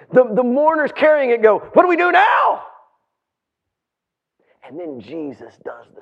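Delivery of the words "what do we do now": higher, climbing cry of confusion; hammed-up alarmed question; mock panic. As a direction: call it out like flustered pallbearers. Acts panicked casket bearers.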